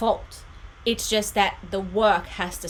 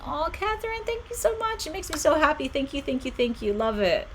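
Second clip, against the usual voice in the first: excited thanks; 9 semitones higher than usual. Real, not acted. Thanking viewer for deck praise.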